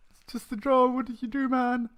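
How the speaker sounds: high-pitched put-on whine of protest; mock protest voice. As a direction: squeak it out in a comic high voice. Voices a mock protest over drone.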